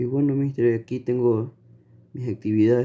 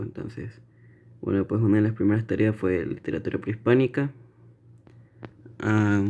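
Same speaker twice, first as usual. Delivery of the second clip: ordinary talk, nothing marked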